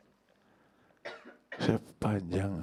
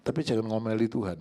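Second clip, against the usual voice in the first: normal talking voice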